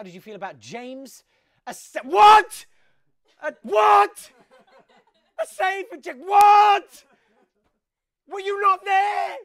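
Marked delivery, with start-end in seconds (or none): 1.7-9.4 shrieking, very high and loud disbelief; incredulous shout. Real, not acted. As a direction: scream your disbelief at the result. Shocked at a result.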